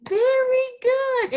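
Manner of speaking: big high "Very good" drawn out; delighted teacher praise; excited praise. Real, not acted.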